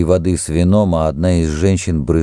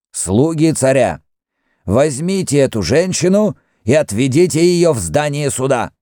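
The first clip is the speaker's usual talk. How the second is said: higher-pitched, ringing official order; loud, distinct command; 8 semitones higher than usual. A narrator's reading. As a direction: order the guards clearly and firmly. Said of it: "произнёс громко и отчётливо". (громко и отчётливо, приказным тоном)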